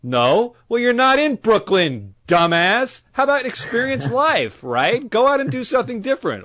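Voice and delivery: high, loud, fed-up rant; exasperated rant. Acted. Ranting at the guy who won't try new things, loud and fed up. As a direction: tear into him, mocking and annoyed.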